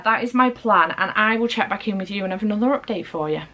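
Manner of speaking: normal talking voice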